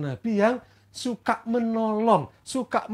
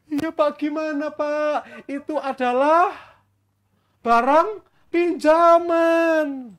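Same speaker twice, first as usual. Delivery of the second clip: high, drawn-out pleading; acted lament; 7 semitones higher, 3 dB louder, slower than usual. Student tells Elisha the axe was borrowed, high drawn-out lament. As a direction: whine the plea slowly, stretching the ending.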